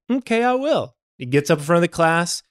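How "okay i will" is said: put-on cocky kid. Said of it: "He's like".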